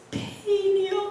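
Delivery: high, drawn-out whimper; imitated tearful cry; mock wail. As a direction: wail it slowly, soft and high. Retold anguished reply to God.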